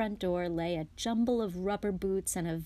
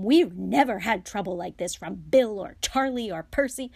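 raging; 5 semitones higher, 9 dB louder than usual. A narrator's reading. Mother scolds sons who took the car, raging. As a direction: rage at them at full volume. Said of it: "her rage broke over them".

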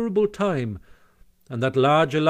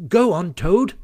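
raised, eager prompt with a rise; immensely interested; 5 semitones higher, 6 dB louder than usual. A narrator's reading. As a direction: egg the storyteller on. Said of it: "said the Mole, immensely interested".

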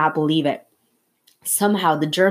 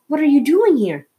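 excited question; 8 semitones higher, 5 dB louder than usual. Acted. Boy excited to see uncle.

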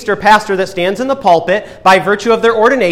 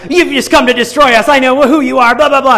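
put-on high-pitched, louder childish protest; babbling demon as kid; 7 semitones higher, 5 dB louder than usual. Demon in a man protesting to Jesus, childish babbling. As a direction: babble in a tantrum, high and loud. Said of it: "This demon in this man is like".

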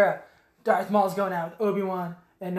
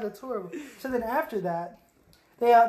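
normal talking voice

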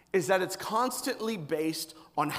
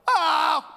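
put-on loud high wail of a waking kid; kid's night cry; 9 semitones higher, 7 dB louder than usual. Kid waking at night, loud high wail. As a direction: cry out like a child startled awake. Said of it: "they're like".

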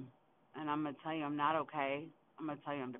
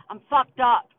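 sudden loud, higher-pitched shout; frustrated outburst; 7 semitones higher, 18 dB louder, slower than usual. Real, not acted. Driver venting about being overloaded, exasperated shout. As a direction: burst out yelling in real exasperation.

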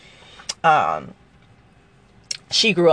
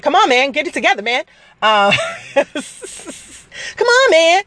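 Chris Tucker; 11 semitones higher than usual. Retold or imitated. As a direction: squeal it out like a wound-up comedian. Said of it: "she reminds me of Chris Tucker".

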